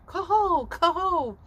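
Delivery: high, ringing swan call; swan cry. Retold or imitated. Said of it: "as we cry".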